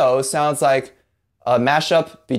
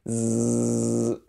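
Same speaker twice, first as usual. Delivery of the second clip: long, soft, level "zzz"; bee-like buzz; 2 semitones lower, 5 dB quieter than usual. Shown as a demonstration. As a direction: buzz tongue against teeth like a fly. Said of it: "a bee flying around or like a fly".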